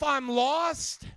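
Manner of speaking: very loud preacher's cry; loud, mocking proclamation; loud declaration. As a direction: belt it out to the whole congregation. Preacher loudly mocks whispering believers.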